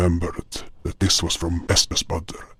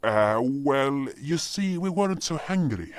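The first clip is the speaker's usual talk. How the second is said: sheepish excuse; 8 semitones higher, 4 dB quieter than usual. Acted. Awkward excuse about soup.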